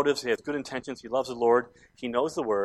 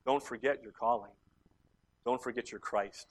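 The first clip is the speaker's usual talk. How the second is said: low, measured pulpit appeal with long pauses; solemn preaching; 5 semitones lower than usual. Real, not acted. Preacher reminding the church of its calling, low and solemn. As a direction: bring it down and let each phrase settle.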